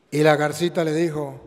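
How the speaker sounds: ordinary delivery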